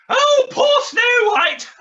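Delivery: loud, slow mock wail. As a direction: wail it like a pantomime dame.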